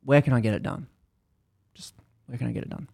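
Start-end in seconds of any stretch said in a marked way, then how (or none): 1.7-2.9 unvoiced, faster, muttered under breath; whispered repeat. Annoyed patient pressing Abby again, in a whisper. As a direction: hiss the question again, impatient.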